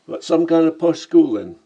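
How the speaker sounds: cheeky Cockney "Blimey, mate"; brash London Cockney greeting; Cockney accent. Acted. Lamb remarking on the narrator's accent, in Cockney. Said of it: "a Cockney in Venice".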